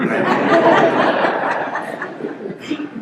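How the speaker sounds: high breathy burst of laughter; real laugh. Real, not acted. Congregation hears him laugh at his brother's remark, airy laugh. After retelling brother call.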